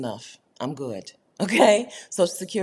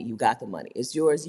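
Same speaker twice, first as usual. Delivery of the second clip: ordinary talk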